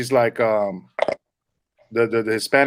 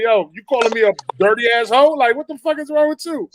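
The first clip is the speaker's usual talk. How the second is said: high, indignant confrontation, rising higher; 9 semitones higher than usual. Acted. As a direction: climb in pitch with affronted disbelief.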